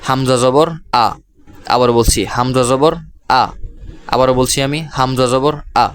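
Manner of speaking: হামজা জবর আ spelled out slowly, ending on a clear open আ; teaching pronunciation. Shown as a demonstration. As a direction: model আ for the students to repeat. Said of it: "আমরা উচ্চারণ করছি".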